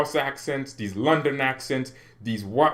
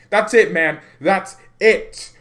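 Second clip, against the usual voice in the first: excited; 8 semitones higher, 7 dB louder, slower than usual. Real, not acted. Celebrates landing the signing.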